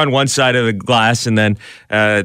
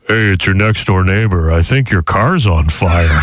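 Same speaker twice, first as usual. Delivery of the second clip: put-on neighbor voice; 4 semitones lower than usual. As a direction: put on a slight phone-prank neighbor voice. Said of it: "put on a voice".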